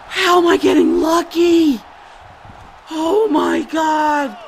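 loud, high shout of disbelief; can't believe your luck; disbelieving shout. Real, not acted. Gamer shouting in disbelief at his own lucky escape.

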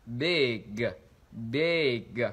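"Big" drawn out with an i-ê vowel; lengthened short I. Shown as a demonstration. Teacher models the short I, "big" held long as i-ê. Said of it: "chúng ta kéo dài cái âm đó ra".

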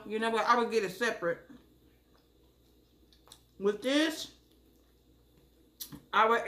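talking between bites; chewing while talking. Real, not acted. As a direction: mouth partly full. Seen 2: Bite at -4; jaw and cheeks keep working while she talks.

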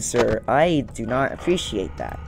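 normal talking voice

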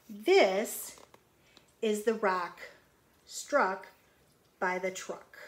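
slow, loud storybook reading; picture-book read-aloud; reading to children. Acted. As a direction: read it out to the kids, word by word.

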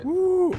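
high, loud "woo"; excited whoop. Real, not acted. Player cheers on reaching a place they like, excited whoop. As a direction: let out a big high cheer.

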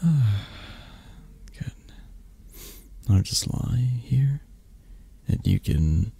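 whispered, unvoiced and slowed; hushed comforting whisper; soft whisper. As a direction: breathe it close and gentle. Soft comforting invitation to cuddle.

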